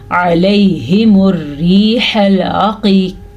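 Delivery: slow recitation, "علیہم" from mid-throat; correct throat ayn. Shown as a demonstration. Teacher modelling the right reading, "علیہم" slow with ayn. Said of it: "آپ اس کو پڑھیں گے".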